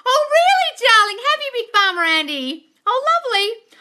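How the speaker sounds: delighted surprise. Real, not acted. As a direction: gush with surprise. Delighted reply to viewer.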